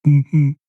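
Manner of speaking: two short closed-mouth grunts; double grunt. Acted. Barman signalling the cat is doing it wrong, double grunt. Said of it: "and grunt twice".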